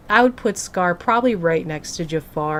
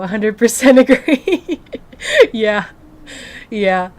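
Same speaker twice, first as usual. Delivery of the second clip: loud, higher, breaking into a real laugh; laughing agreement; 3 semitones higher, 8 dB louder than usual. Real, not acted. Streamer agreeing with a viewer's comment, laughing. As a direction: agree heartily through laughter.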